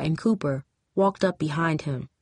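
plain reading voice

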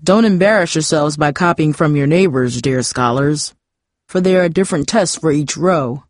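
formal, cautioning teacher voice; warning teacher. A narrator's reading. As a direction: warn the class with pompous authority.

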